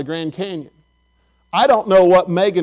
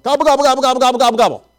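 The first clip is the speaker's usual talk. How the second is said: high run of turkey gobbles; imitated rapid gobbling; 5 semitones higher, 3 dB louder, much faster than usual. Bell ringer out-gobbling Daniel, high rapid playful gobbling. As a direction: rattle off gobbles in one breathless string. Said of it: "starts doing more than two gobbles at him".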